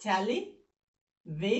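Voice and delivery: "টেলিভিশন" slowly split into three chunks; te-li, vi, shon, each piece of "টেলিভিশন" standing alone; broken into syllables. Shown as a demonstration. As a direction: break "টেলিভিশন" apart with clear pauses between syllables. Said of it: "তিন syllable".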